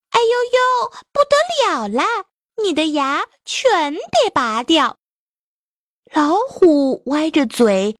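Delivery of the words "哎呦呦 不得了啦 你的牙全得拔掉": much higher, alarmed fox cry; fox exclaiming. Acted. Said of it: "就叫了起来".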